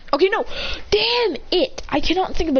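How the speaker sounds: frustrated self-scolding yell; real frustration. Real, not acted. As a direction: burst out annoyed at yourself. Frustrated with own story.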